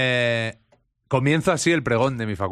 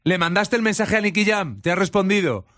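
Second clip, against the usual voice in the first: sleepwalking insistence; 7 semitones higher than usual. Retold or imitated. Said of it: "Y le digo".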